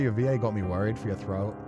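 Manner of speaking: ordinary talk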